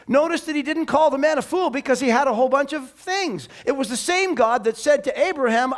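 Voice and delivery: ordinary delivery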